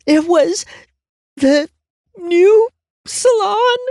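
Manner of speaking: very high, slow, rising sob-broken question; sobbing hiccups. A narrator's reading. Crying client confessing the rival salon, high sob-broken question. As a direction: hiccup the words out through tears. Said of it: "in between sobbing hiccups".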